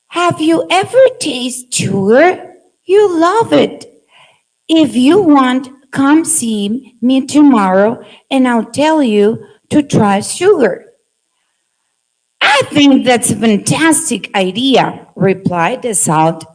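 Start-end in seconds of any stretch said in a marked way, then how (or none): 0.1-10.9 higher, excited invitation; eager sugar ant. Acted. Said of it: "make different voices". 12.4-15.1 salt ant accepting the invitation, delighted. Acted. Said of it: "make different voices".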